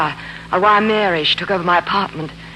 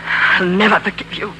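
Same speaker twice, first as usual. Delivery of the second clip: furious; 3 semitones higher than usual. Acted. Furious refusal after struggle.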